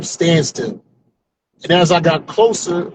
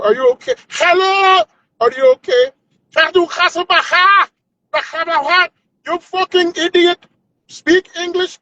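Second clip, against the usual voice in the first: trolling in a badly faked, high Indian accent; mock Indian accent; 11 semitones higher than usual. Man trolling a telemarketer, exaggerated Indian accent. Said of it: "in a worse accent than what they have".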